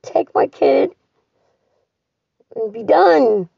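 real sobbing cries, high and loud; loud tearful goodbye to her dad; crying out. Real, not acted. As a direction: weep it out, voice breaking. Crying farewell to dying dad.

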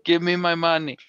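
mock Jamaican voice; bad Jamaican accent. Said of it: "can't do a Jamaican accent".

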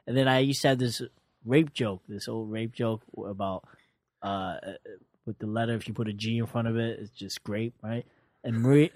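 usual speaking voice

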